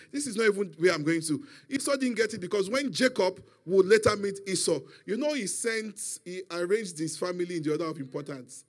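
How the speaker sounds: normal talking voice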